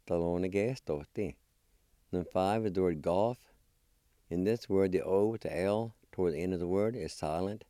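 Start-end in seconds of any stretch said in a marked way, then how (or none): none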